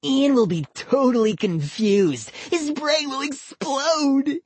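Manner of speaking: put-on high slowing gleeful boy; gleeful kid voice. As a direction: savor the prank. Boy character voice.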